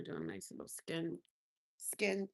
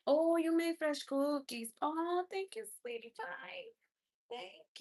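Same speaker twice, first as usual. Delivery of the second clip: delighted; 8 semitones higher, slower than usual. Real, not acted. Thanking for cookies, gushing.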